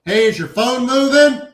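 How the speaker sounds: loud, high call, drawn out slowly; calling up from underneath. Retold or imitated. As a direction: shout it up through the floor, slow and puzzled. Yanking wires under the building.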